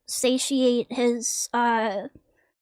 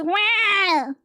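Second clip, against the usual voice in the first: put-on angry baby; 6 semitones higher, slower than usual. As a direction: throw a furious baby fit. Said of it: "She goes".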